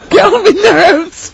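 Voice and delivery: high, loud, drawn-out mimicry through laughter; mocking echo. Co-host mocks friend's complaint, high laughing mock yell. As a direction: shriek it back in gleeful mockery.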